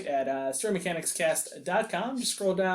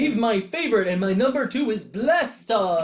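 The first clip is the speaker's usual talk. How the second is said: mock-eager fanboy, slightly raised voice; hammed-up fan enthusiasm; gushing fan; 4 semitones higher, 3 dB louder than usual. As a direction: gush like a devoted fan. Acting out the Psycho Man fan.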